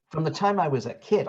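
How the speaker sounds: ordinary talk, nothing marked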